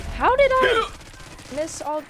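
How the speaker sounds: surprised outburst. Real, not acted. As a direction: blurt it out in disbelief. Frustrated gaming exclamation.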